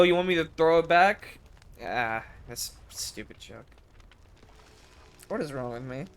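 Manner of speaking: usual speaking voice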